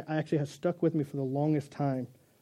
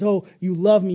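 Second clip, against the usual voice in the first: louder, adoring "Oh, you love me"; eager dog; 3 semitones higher, 8 dB louder than usual. Pretend. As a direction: gush it like a happy dog. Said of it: "the dog says".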